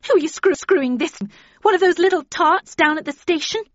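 scornful outburst, rising to a high pitch; spitting, voice rising. A narrator's reading. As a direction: start indignant and climb to a loud accusation. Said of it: "her voice rising".

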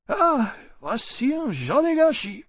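high, despairing French exclamation. A narrator's reading. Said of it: "in tones of despair".

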